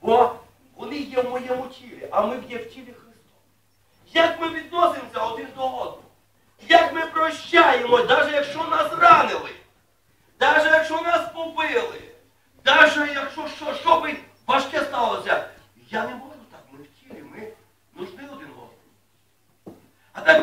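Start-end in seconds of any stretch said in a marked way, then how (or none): 4.1-15.5 loud emphatic sermon line; fervent preaching. Acted. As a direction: drive the point home to the congregation.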